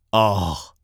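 understated, sceptical bear; a mild sigh of disbelief; disbelieving. A narrator's reading. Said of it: "sagt der Bär ungläubig". (ungläubig)

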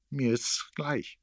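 slow, high-pitched pert indifference; cheeky. A narrator's reading. Erik lets himself be begged, answering cheekily. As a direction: toss it off cheekily, drawn out. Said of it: "sagte er keck".

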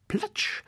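breathy whispered splash sound. Acted. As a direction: hiss the splash as the duck jumps.

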